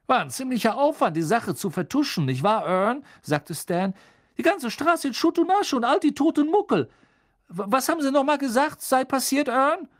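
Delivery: high, loud, quick chatter; Stan gossiping. Acted. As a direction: rattle on to the driver.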